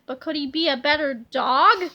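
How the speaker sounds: usual speaking voice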